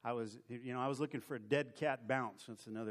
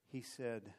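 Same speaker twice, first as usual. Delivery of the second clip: usual speaking voice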